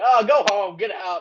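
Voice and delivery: high mock heckle from the other dads; hammed-up dismissive jeer. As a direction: play dads shooing off a show-off. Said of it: "hear all the other dads just like".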